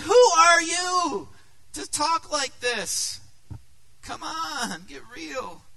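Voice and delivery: high, loud disbelief that fades off; who do you think you are, cried out high; incredulous outburst. Retold or imitated. Woman scoffing at Jesus, high incredulous cry.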